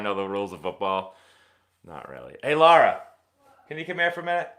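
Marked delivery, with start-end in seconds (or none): 2.4-4.5 loud, higher call across the house. Real, not acted. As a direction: call her from another room. Calling someone into the room.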